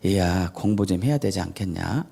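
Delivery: soft, low, kindly coaxing parent; gentle parent. Retold or imitated. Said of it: "이렇게 하는 것과".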